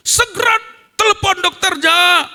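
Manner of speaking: high, urgent demand; re-enacted angry order. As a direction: order the nurse in retold fury. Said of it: "betul-betul marah". (perintah marah bernada tinggi)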